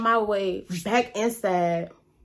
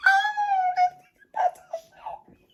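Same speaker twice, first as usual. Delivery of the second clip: high-pitched, hammed-up tearful wail; panicked wail. Storyteller reliving the shootout, panicked high wail. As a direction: act out wailing in terror for your life.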